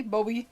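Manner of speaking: Bobby with a long O; pronounced BOH-bee. Shown as a demonstration. Host sounding out a viewer's spelled name, Bobby as BOH-bee. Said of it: "B O B B E E".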